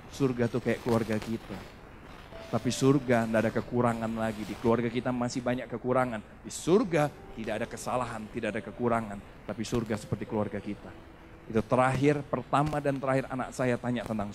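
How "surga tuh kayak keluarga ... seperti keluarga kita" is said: wife explaining gently. Retold or imitated. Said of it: "Istri saya bilang ini".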